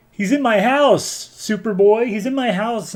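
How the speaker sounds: high, loud mock whine of outrage; exasperated complaint. Superman to Superboy, locked out of his fortress, exasperated. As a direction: imitate an indignant hero, pitched well up.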